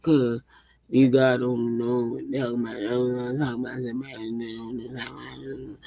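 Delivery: low, sinking joke voice; put-on deep voice. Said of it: "that's an interesting way of talking".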